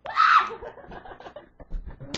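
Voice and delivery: shrieking laugh. Real, not acted. Startled by a jumping frog.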